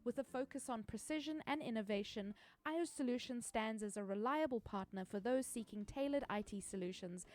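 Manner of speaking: soft, even sponsor read. Acted. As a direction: read it like a polished ad. Reads a sponsor ad.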